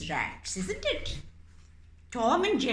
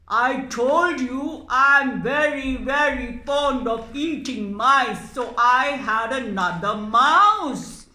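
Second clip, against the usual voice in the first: louder, boasting cat voice; boastful cat; 9 dB louder than usual. Acted. Cat puppet bragging to the mouse, loud. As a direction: brag about eating mice.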